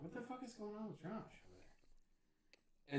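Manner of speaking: hushed, slow puzzled muttering under the breath; retold hushed aside. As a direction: mutter it to yourself, baffled. Narrator muttering while watching.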